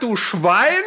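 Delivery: mock outrage. Mock outrage at fat remark.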